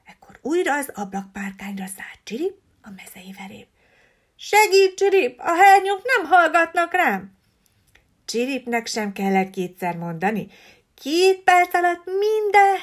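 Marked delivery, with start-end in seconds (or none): 4.3-7.4 high, loud panicked parrot; frantic parrot cry; desperate plea. A narrator's reading. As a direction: beg the sparrow for help. Parrot pleads for help.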